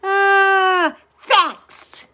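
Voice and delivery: high, loud exclaimed agreement; loud happy cry of agreement; excited shout. Real, not acted. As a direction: shout it out like a yes.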